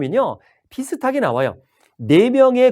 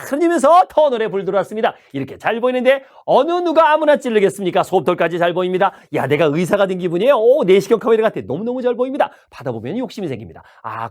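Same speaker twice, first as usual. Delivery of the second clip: subway peddler; 3 semitones higher than usual. Shown as a demonstration. 지하철 승객들에게 물건 파는 잡상인 말투. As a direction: sell it like a subway vendor working the car. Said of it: "제가 지하철 잡상에 좀 변신을 좀 할게요".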